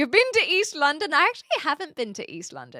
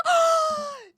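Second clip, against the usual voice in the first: surprised gasp; 4 dB louder than usual. Real, not acted. Remembers visiting Cockermouth.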